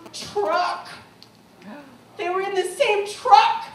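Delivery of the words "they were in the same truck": much louder and higher, bursting out; excited. Real, not acted. Speaker to audience, bursting out the reveal in excitement. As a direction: shout the revelation in amazement.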